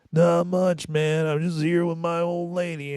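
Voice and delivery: mock the burnout kid bragging about his girlfriend; stoner dude voice. Mimicking the kid to a friend, lazy high voice. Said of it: "This is what he says to me".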